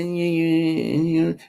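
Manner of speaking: soft, hesitant imitation of a frightened talker; mock scared mumbling. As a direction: mumble it timidly, as a parody. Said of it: "like you're scared".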